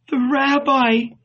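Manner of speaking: high, faint-hearted plea, not hushed; weak murmur. A narrator's reading. Mary leaning on the wall, weakly pleading. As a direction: plead weakly, pitched well up. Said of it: "she murmured weakly".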